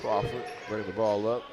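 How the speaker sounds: brisk basketball commentary; live play-by-play. Acted. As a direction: call the action as it happens.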